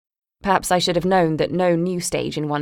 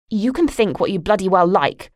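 angry outburst; 3 semitones higher, 4 dB louder than usual. Acted. Daughter losing her temper with her mother, loud and angry. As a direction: let her have it at last.

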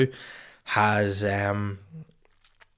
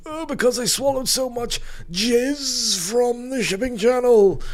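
put-on old person voice; 14 semitones higher, 4 dB louder than usual. Imitating aged swimmer.

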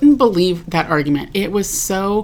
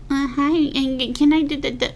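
high-pitched imitation of a hesitant interrupter; put-on higher voice, politely interrupting; tentative student; 8 semitones higher than usual. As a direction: mimic a timid student butting in, voice lifted. Voicing students who interrupt her.

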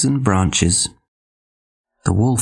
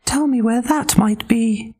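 very high pig voice; eager little pig; 14 semitones higher than usual. Acted. Pig voice in story.

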